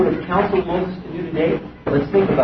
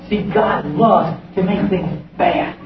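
ordinary talk, nothing marked

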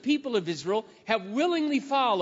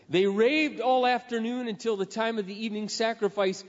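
usual speaking voice

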